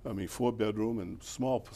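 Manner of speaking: normal talking voice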